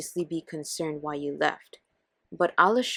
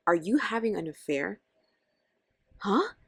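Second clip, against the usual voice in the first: normal talking voice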